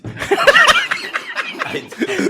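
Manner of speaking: high, helpless laughter through the words; real squealing laugh-talk; laughing protest. Real, not acted. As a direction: protest while cracking up. Laughing protest when asked about drugs.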